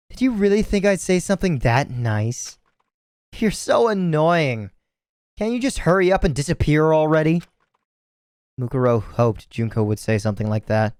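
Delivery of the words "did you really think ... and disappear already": sneering high-pitched taunt; high mocking girl voice. Junko taunting her sister.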